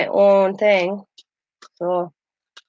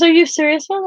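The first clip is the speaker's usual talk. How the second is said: mock incredulous son; 10 semitones higher, 3 dB louder than usual. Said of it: "He goes".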